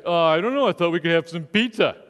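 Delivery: mumbling, laughing clueless guy. Retold or imitated. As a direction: play the guy who has no idea. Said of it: "Most guys say".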